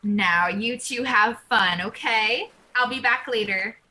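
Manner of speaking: ordinary delivery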